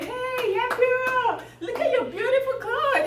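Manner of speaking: high-pitched acted cheer; crowd cheering. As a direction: hail the emperor like an adoring crowd. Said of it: "everybody was cheering for him".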